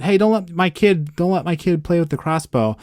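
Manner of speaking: half-hearted retold yell; mock dad yell. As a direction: call out like an alarmed dad, without real volume. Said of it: "the dad yells out".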